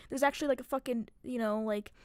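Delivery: usual speaking voice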